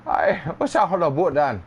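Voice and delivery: mock Irish accent. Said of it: "when someone from Ireland hears someone from California".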